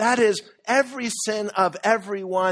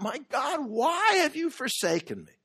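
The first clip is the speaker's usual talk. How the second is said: higher, strained cry of anguish; desperate cry; 7 semitones higher than usual. Retold or imitated. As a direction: cry out to God as if abandoned. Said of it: "in desperation, he cried out".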